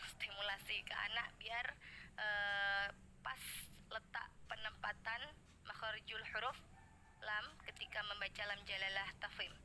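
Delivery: ordinary delivery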